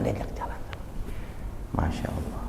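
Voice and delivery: whispered in awe; awed whisper. Real, not acted. Preacher whispering in awe of the Prophet's calm. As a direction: breathe it out reverently.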